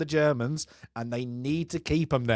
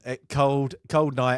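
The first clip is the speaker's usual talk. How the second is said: ordinary delivery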